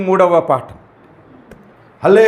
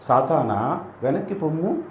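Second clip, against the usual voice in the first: soft, timid telling-off; a weak quiet rebuke; meek soft rebuke; 3 semitones lower, 8 dB quieter than usual. Shown as a demonstration. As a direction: say it gently as if afraid. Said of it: "పోనీ నేను చెప్తాను, మీరు చూడండి".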